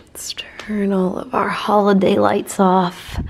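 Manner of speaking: slow bedtime whisper. Real, not acted. Vlogger at bedtime turning lights off, slow whisper.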